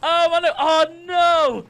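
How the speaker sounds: loud, high wail of dismay; dismayed cry. Real, not acted. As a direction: cry out as the streak collapses. Kill streak suddenly ends in death.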